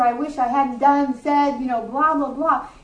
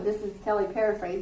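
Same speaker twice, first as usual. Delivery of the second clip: quick, soft, lower parenthesis; hushed aside; 5 semitones lower, 8 dB quieter, faster than usual. Real, not acted. Preacher admits her own paraphrase, quick quiet aside. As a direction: tuck it in quickly under the breath. Drops an aside inside a quote.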